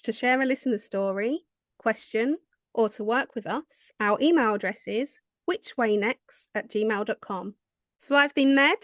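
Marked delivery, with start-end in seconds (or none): 4.0-7.5 slow and clear. Acted. Spelling out the email slowly.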